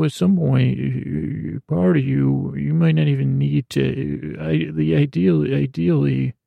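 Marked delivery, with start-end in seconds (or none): none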